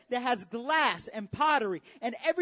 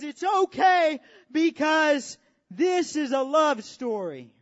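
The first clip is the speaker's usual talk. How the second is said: loud earnest declaration; 4 semitones higher, 9 dB louder, slower than usual. Acted. Jesus's words as nails go in.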